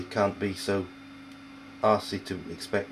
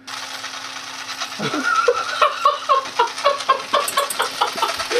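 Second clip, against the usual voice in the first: long, high-pitched laughing fit; real laughter, wheezing up high; helpless laughter; 4 dB louder than usual. Real, not acted. Cook cracks up at a failed paste, helpless laughter.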